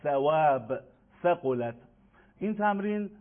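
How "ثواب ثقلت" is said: "ثواب، ثقلت" each opening on th; Arabic th at the start of "ثواب، ثقلت"; tongue between teeth. Shown as a demonstration.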